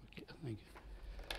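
breathy whispered thanks, off to one side; whispered aside. Real, not acted. Presenter quietly thanking a helper mid-talk, whispered aside. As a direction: murmur it quietly to the person helping, not to the room. Aside while handed something.

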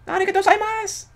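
put-on very high, squeaky anime girl voice; mock cute anime voice. Fan parroting a character's thank-you in falsetto.

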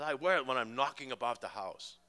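put-on light English accent, casual and offhand; a breezy English shrug of an answer. Paul answering about the t-shirt, light English accent.

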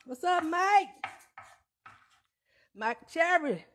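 high, loud hype for a friend; big up the chef watching live; cheering him on with real delight; excited shout-out. Real, not acted. Greeting viewer Mike on live.

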